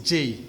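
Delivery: J said as "jay"; J as English "djay"; English letter name. Shown as a demonstration. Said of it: "la lettre J en anglais".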